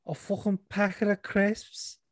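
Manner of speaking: put-on high Scouse accent; mocking Scouse schoolgirl; Scouse Hermione. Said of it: "give her a Scouse dialect".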